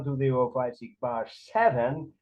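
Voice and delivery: ordinary talk, nothing marked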